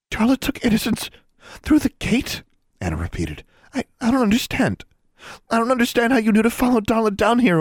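high, rattled questions; panicked. A narrator's reading. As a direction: stammer in confused panic.

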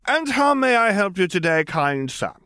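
high, grandly polite greeting; mock courtesy. A narrator's reading. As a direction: call down like an over-courteous host. Mock-courteous greeting from roof, bowing.

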